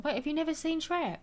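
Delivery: can't believe what you just read; surprised disbelief. Real, not acted. Streamer to viewer who never saw Shrek, disbelieving.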